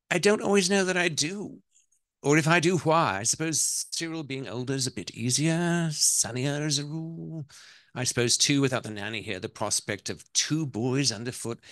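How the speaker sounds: put-on high-pitched, hesitant English-accented wife. Wife defends herself to mother-in-law about her sons, English accent. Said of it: "I'm gonna do the ... the accent".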